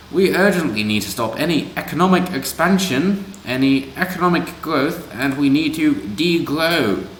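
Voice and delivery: a silly put-on Mog accent read of the activist's words; mock Mog accent. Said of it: "He concluded".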